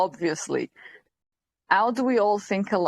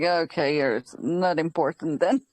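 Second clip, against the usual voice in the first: low, unimpressed inner verdict; retold dismissal, dropped low in pitch; dismissive aside; 8 semitones lower than usual. Stylist privately writing off the receptionist, dismissive.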